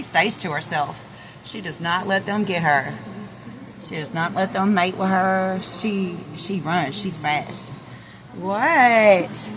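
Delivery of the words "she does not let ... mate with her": ordinary delivery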